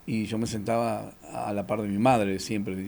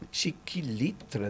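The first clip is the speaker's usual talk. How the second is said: slow exasperated curse; 8 dB quieter, much slower than usual. Retold or imitated. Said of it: "dije yo".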